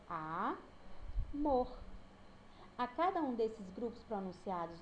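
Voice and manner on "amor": "Amor" broken into A, pause, mor; syllables pronounced separately. Shown as a demonstration. Teacher demonstrating sound groups to students, "Amor" in separate syllables. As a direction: hold the A of "Amor", stop, then give mor alone. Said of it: "pronunciados separadamente".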